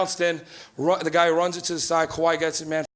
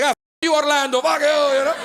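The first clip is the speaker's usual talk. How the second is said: higher, jeering dad cursing Orlando; mock taunt; 6 semitones higher, 3 dB louder than usual. Dad gloating at beaten Orlando, mock jeer. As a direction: spit it at Orlando, half joking. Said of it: "He's like".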